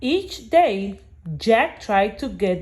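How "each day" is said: ordinary delivery, nothing marked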